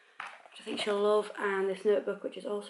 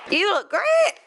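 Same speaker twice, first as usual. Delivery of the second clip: excited praise; 12 semitones higher, 12 dB louder, slower than usual. Real, not acted. Cooing at little Rose.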